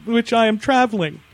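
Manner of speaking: loud, stiff put-on gawker voice; a pompous nosy driver; nosy rubbernecker. Still mocking rubberneckers in a loud, stiff gawker voice.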